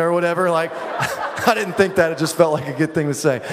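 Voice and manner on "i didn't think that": ordinary talk, nothing marked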